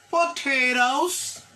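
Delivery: "potatoes" drawn out slowly with a clear final s; slow model word. Shown as a demonstration. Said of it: "Faut entendre des petits sons". ("potatoes" lentement, s final marqué)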